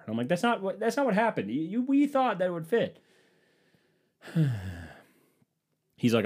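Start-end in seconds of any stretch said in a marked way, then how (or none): 0.3-3.0 squeaky, defensive mock objection; high-pitched protest. Man protesting partner's account to her dad, mock indignation. 4.2-4.9 long audible exhale; exasperated sigh. Retold or imitated. Man hearing partner tattle to her dad, exasperated sigh. Retold defeat during the phone call.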